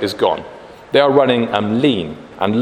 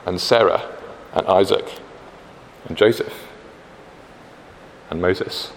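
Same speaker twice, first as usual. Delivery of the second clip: ordinary talk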